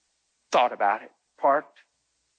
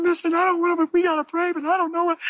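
high, hammed-up fretting church voice; mock gossiping churchgoer; 14 semitones higher, 4 dB louder, faster than usual. Gossiping churchgoers fretting over the pastor, high and mocking. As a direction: whine it like a pious gossip. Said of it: "they'd be going".

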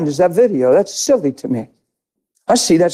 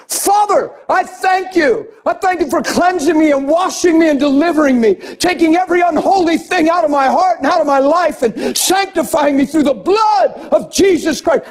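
loud fervent prayer; 8 semitones higher than usual. Retold or imitated. Pastor reenacting his prayer to the Father, shouted and fervent. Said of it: "I started freaking out, screaming loud".

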